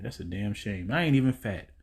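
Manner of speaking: ordinary delivery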